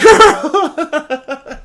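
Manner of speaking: ordinary delivery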